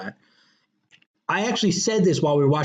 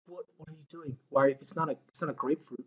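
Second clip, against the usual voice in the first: mock quiet; 12 dB quieter than usual. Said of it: "And then he's like".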